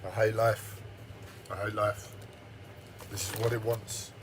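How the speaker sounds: very quiet, low and depressed; real hushed despair; shut-up, subdued voice. Real, not acted. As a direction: murmur it low, drained after an outburst. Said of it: "I've shut up".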